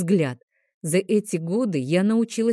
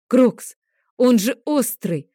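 loud, higher scolding bark; angry snarl; 6 semitones higher, 6 dB louder, slower than usual. Acted. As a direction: snap at the dragon in alarm. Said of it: "рыкнула я на дракона". (злобный окрик, громко)

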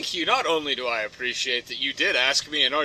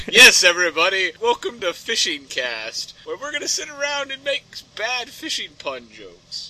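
much louder, then higher host announce; podcast host intro; 5 semitones higher, 5 dB louder than usual. Pretend. Host welcoming listeners to Fishing Cast, loud. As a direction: open the show like a cheesy host.

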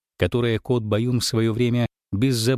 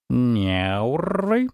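drawled. Acted. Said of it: "протянул Баюн".

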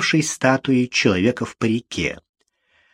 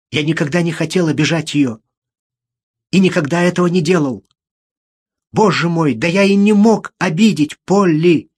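rising, insistent plea of a wrongly accused man; desperate denial, gathering force; agitated protest; 5 semitones higher, 4 dB louder than usual. A narrator's reading. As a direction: protest innocence, building higher and louder. Condemned man protests his innocence.

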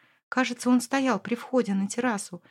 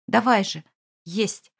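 ordinary delivery, nothing marked

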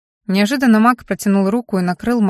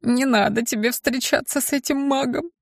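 a hint of a whimpering, higher imp voice; whining imp; 5 semitones higher, 5 dB quieter than usual. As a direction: whine it like a small sulky imp. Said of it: "захныкал Гизмо".

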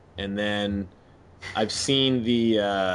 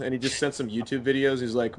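ordinary delivery

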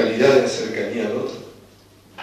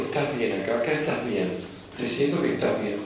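tierno con la planta; 8 dB quieter than usual. Retold or imitated. Habla con cariño a la planta.

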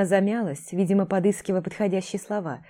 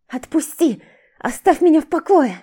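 struggling against restraints, hissing; 9 semitones higher, 8 dB louder than usual. Acted. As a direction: hiss it while pulling away. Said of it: "шипела девушка".

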